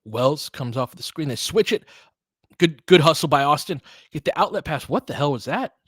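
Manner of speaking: ordinary talk, nothing marked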